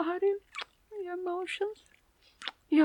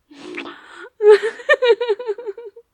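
tearful laugh; 7 semitones higher, 11 dB louder than usual. Acted. Laughing in afterglow.

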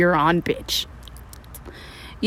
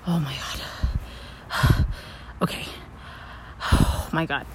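breathless panic; 6 semitones lower, 8 dB quieter, slower than usual. Acted.

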